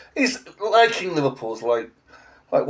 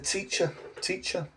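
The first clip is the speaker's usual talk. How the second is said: put-on higher, softer child's voice; child pupil; 4 semitones higher, 11 dB quieter than usual. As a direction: imitate a small pupil calling the teacher. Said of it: "a child said to me".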